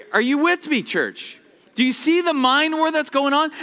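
usual speaking voice

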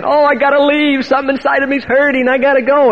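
high, urgent put-on woman's voice; imitated distress. Woman fleeing the praying men, imitated distress. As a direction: plead to get away, pitched well up.

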